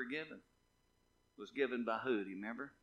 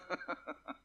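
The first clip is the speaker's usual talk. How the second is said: light, soft run of chuckles; 3 semitones higher, 5 dB quieter than usual. Real, not acted. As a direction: chuckle quietly to yourself. Chuckles after thanking congregation.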